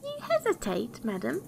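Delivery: very high, incredulous squeak; little dwarf piping in outrage; indignant dwarf cry. A narrator's reading. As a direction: leap right up in affronted disbelief. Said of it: "cried the dwarf".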